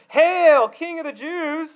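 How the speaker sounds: high, drawn-out herald's cry; mocking salute. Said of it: "they began to salute him".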